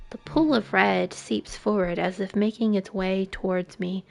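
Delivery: ordinary delivery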